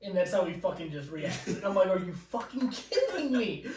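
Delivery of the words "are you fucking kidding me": high, drawn-out incredulous protest breaking into laughter; laughing disbelief. Retold or imitated. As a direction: protest slowly through a laugh. Exasperated reaction, laughing as retold.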